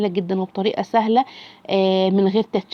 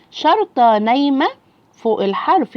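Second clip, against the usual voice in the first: slow drilling rule; 3 semitones higher than usual. Shown as a demonstration. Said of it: "teacher drills the rule".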